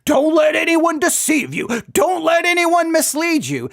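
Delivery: high-pitched, loud mocking preacher shout. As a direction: mimic a preacher hollering a warning, high and drawn out. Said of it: "hilarious in these sermons".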